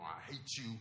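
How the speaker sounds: ordinary talk, nothing marked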